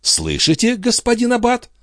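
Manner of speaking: high, rising triumphant question; high-pitched, pointed exclamation; exclaiming. A narrator's reading. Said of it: "воскликнул Био".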